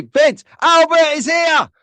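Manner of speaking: high, slow, loud call; announcing loudly. Real, not acted. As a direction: shout the arrival to everyone. Announcing a viewer's arrival.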